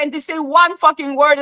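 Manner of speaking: ordinary talk, nothing marked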